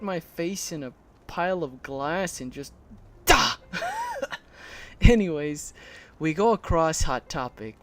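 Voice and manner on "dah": loud, high "dah" burst; imitated smash noise. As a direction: blurt a sudden impact noise. Imitates smashing face into glass.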